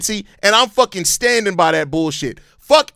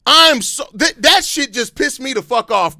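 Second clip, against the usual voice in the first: ordinary talk, nothing marked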